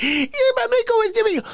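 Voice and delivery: very high, rapid-fire squeal; hammed-up high-pitched girly gabble; imitated flag girl. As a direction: squeal it fast in a mock girl's voice. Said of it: "She's like".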